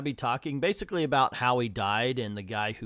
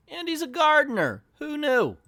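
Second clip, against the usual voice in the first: mock surprise; 7 dB louder than usual. Real, not acted. Mock amazement about author.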